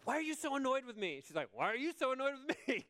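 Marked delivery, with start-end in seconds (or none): 0.1-1.2 high, quick, indignant question; mock exasperation. Acted spat with partner. 1.5-2.9 mock-indignant partner, high and fast; imitated exasperated retort. Partner fires same question back.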